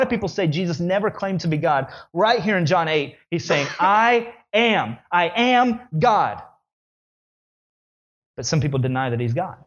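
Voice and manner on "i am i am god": very slow, higher I am God; preacher stressing the claim; emphatic proclamation. Acted. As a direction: drive each word home.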